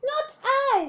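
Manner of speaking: high, loud sly snake; the snake denying it in a high voice. Acted. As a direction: hiss it like a snake. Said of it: "said the snake".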